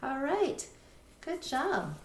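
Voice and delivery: teacher praising the class's work; cheerful praise. Real, not acted. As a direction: praise them with a lift. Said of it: "praising the class".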